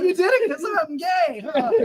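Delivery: high accusing shout; mock accusing girl. Hit girl accusing the driver, high and shouting. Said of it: "She's like".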